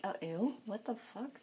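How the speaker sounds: soft, questioning disbelief; retold hushed affront; incredulous echo. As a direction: echo it back quietly, taken aback. Retold offended echo of client.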